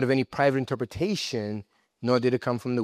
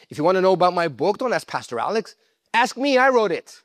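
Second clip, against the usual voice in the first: higher, louder, playful God voice, laughing; God's voice; 7 semitones higher, 5 dB louder than usual. Acted. Preacher voicing God who wrote the book, high playful laugh. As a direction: play God teasing, fast and amused.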